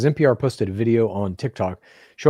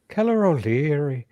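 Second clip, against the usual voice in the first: playful mock Irish lilt; Irish accent; 4 semitones higher than usual. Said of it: "Your Irish accent?".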